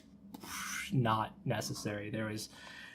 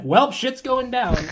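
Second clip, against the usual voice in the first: louder, higher, slow mock dread; mock alarmed exclaim; 11 semitones higher, 11 dB louder, slower than usual. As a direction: announce the doom with a grin. Mock reaction to anime trope.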